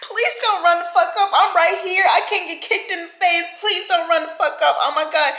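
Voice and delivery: high, loud frantic begging with a laugh; mock panicked pleading. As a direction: plead for your own safety, half laughing. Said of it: "I'm like".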